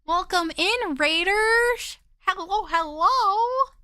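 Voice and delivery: high bubbly streamer greeting; cute anime streamer. Acted. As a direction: chirp a bright welcome to the raiders.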